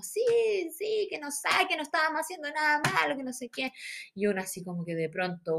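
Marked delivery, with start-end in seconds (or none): 0.0-3.7 súplica aguda y agitada; protesta coral imitada. Retold or imitated. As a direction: imitar al grupo reclamando en voz alta de tono. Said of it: "todos así como". (reclamo agudo de todas, exagerado)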